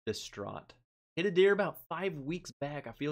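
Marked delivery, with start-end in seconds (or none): 0.1-0.7 a quiet, deflated echo of the word; softer, let it sink; mock despair. Host repeats his lament to chat, quietly deflated. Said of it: "I was distraught".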